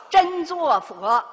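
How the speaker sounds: 真做佛 spoken slowly and weightily, each syllable pressed; slow emphatic stress. Real, not acted. Teacher stressing to students that 真做佛 matters most, slow and weighty. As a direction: lean hard on 真做佛, drawn out.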